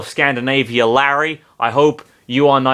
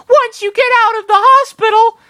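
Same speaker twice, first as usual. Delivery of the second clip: put-on much higher, louder girlish threat; menacing Amy; 7 dB louder than usual. Amy threatening Sonic, much higher and louder. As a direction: sweetly demand the date.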